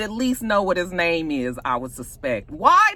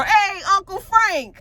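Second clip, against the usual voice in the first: mock greeting; 10 semitones higher, 4 dB louder, slower than usual. [name] greets uncle at door.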